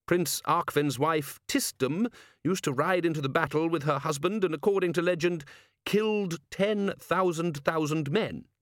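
lightly voiced patrician, explaining at leisure; learned patrician. Patrician informing his men about Clatchian history, measured lecture.

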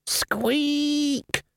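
tiny high rat squeak. Acted. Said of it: "The Raven jumped up and down excitedly".

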